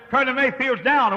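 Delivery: loud, high urgent report; retold alarm. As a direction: call out the bad news at full pitch.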